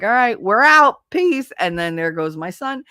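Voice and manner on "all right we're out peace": high, loud, flippant goodbye; chirpy dismissive exit line; breezy brush-off. Retold or imitated. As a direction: toss it off like she's stealing him away. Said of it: "some bitch is gonna come along and be like".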